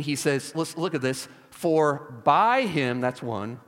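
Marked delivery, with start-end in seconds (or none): 1.5-3.0 BY drawn out slowly and leaned on; stress on BY. Shown as a demonstration. Preacher showing the first prepositional phrase, emphatic. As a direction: stretch the word BY, slow and weighty.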